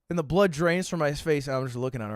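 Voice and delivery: ordinary talk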